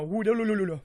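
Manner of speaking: babbled rolling machine noise; imitated treadmill whirr. Describing treadmills in the gym corner, imitated whirr. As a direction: make a silly looping treadmill sound.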